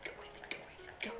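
whispered chant under the breath; self-hyping whisper. Real, not acted. Player hyping up themselves, whispered chant. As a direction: whisper a hype chant to yourself. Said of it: "I'm hyping up myself".

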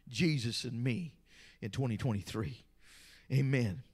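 very low, soft, solemn voice; subdued, prayerful pulpit delivery; hushed sermon close. Real, not acted. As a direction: drop to a quiet vow, with pauses.

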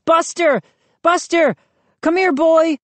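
high, urgent calls to a dog; shouting up. A narrator's reading. Children calling their dog at the window, shouting. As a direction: call loudly up to someone far above. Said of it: "started shouting up to the window".

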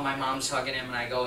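ordinary delivery

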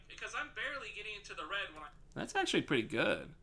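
normal talking voice

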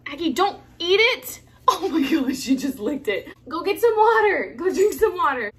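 loud, urgent yelling; alarmed shouting. Real, not acted. Owner to dog that licked paint, alarmed shouting. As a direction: shout orders in alarm, pitch up.

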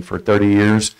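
normal talking voice

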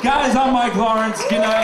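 big, loud goodnight; loud sign-off. Real, not acted. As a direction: shout your goodbye to the crowd. Comic signs off loudly.

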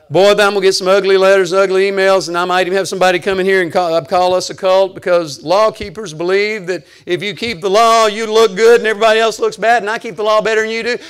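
loud, quick-running pulpit rant; driving, insistent preacher delivery; full-force preaching. Real, not acted. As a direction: rail at the law keepers without pausing.

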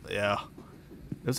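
ordinary talk

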